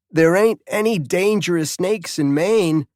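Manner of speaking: put-on a know-it-all kid talking back; lightly voiced teenage boy; sweaty teen boy.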